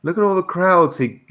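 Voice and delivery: ordinary talk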